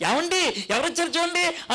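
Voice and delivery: high mock call to the husband; wife calls her husband to come see the guest; calling out loud. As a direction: shout for him across the house. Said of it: "గట్టిగా అరిసింది".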